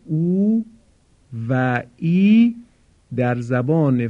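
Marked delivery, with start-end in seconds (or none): 0.1-2.6 او and ای drawn out slowly, each vowel held long; long vowels demo. Shown as a demonstration. Teacher modelling long vowels for Quran students, slow and drawn out. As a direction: stretch out او, then و ای, like a teacher modelling sounds. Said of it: "تلفظ صداهای کشیدهٔ".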